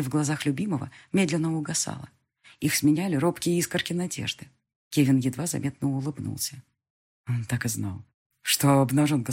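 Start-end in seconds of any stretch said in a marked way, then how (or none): none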